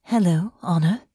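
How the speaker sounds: tentative. Acted. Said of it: "gave Honor a tentative smile".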